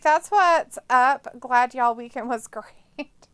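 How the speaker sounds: slowly reading a chat message; drawn-out, deadpan reading. Retold or imitated. Streamer reads a viewer's chat slowly. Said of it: "Robert's like".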